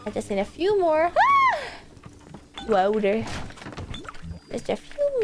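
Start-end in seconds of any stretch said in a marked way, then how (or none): 1.2-1.6 startled yelp. Real, not acted. Sudden fright.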